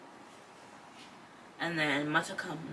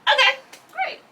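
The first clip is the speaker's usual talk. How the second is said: sarcastic; 13 dB louder than usual. Retold or imitated. Sarcastic reaction to bad news.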